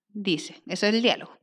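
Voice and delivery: usual speaking voice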